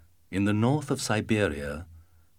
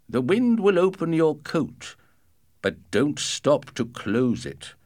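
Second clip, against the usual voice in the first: father voice; 5 semitones higher than usual. Acted. Father's long instructions, slow.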